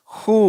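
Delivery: ஹூ with a breathed h and long oo. Shown as a demonstration. Teacher modelling WH words said with h, clear h. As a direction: read ஹூ alone, no v sound. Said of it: "ஹ் என்ற உச்சரிப்பு வரும் வார்த்தைகள்".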